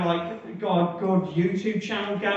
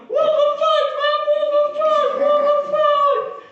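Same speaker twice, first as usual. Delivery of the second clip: high, loud, panicked shrieking; shocked scream; 6 dB louder than usual. Retold or imitated. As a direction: scream it in shock from the water, dragging the last one out. Shoved into the pool by the butler.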